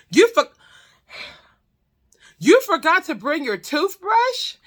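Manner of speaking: high, incredulous scolding; indignant outburst. Retold or imitated. Furious at the man who used her toothbrush, indignant outburst. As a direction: tear into them, pitch jumping up.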